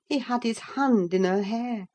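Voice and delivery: usual speaking voice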